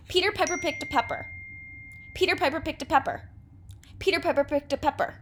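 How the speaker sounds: Peter Piper picked a pepper rattled off quickly; fast tongue twister. Shown as a demonstration. Teacher speeding through a twister for students, fast. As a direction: fire off the P words fast. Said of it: "Ooh, that was hard".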